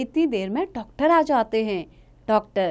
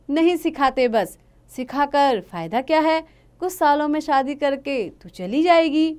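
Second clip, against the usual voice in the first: firm, dismissive father; stern father; 6 semitones higher, 4 dB louder than usual. Acted. Father flatly refuses to teach his daughter, stern. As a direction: shut the topic down.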